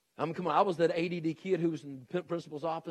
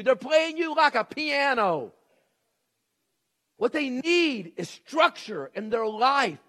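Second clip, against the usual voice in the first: loud, high, drawn-out preaching shout; full-force preacher's exhortation; emphatic preaching; 8 semitones higher, 10 dB louder, slower than usual. Real, not acted. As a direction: belt it out to the parents, stretching key words. Preacher's emphatic outburst to parents.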